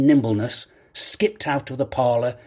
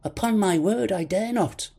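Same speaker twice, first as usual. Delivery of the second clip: put-on high, pleading boy's voice; frightened young boy; 10 semitones higher than usual. A narrator's reading. Boy refusing the blind man, frightened boy's voice.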